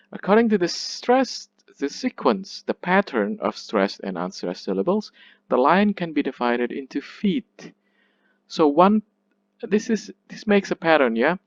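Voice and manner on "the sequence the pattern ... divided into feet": ordinary talk, nothing marked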